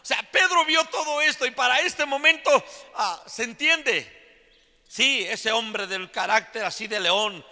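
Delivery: high, loud preaching at full force. Real, not acted. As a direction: belt it out to the whole congregation. (predicación a todo pulmón, con voz alta)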